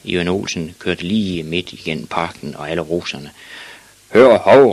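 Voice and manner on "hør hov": loud, raised challenge; loud angry hail; man's shout. A narrator's reading. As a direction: yell to stop someone. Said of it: "råbte en mand".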